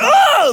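short, very high, loud yelp; freaked-out scream. Retold or imitated. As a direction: scream as the panic hits. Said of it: "and I freak out".

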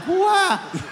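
a high, shocked what; stunned what. Retold or imitated. Son stunned by his father's leprechaun line, shocked high what. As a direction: jump way up in disbelief.

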